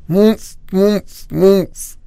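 high muffled noises through a gag; mouth full. A narrator's reading. Little sister trying to speak with a sock in her mouth, muffled. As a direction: try to talk with a stuffed mouth. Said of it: "Don't speak with your mouth full".

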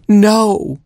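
high-pitched storybook scream. A narrator's reading. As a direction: cry out in high-pitched protest. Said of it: "screamed Gerbil".

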